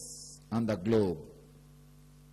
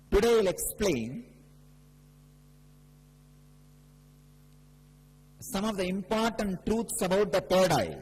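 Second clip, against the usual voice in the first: higher, louder preaching voice, slowing on key phrases; fervent preaching; 6 semitones higher, 4 dB louder than usual. Acted. Guru preaching about the third eye to devotees, raised and fervent. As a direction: proclaim it to the whole hall.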